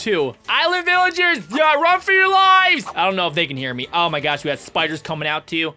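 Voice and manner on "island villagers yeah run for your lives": shouted warning. Real, not acted. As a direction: bellow the warning across the water. Calling out warning to villagers.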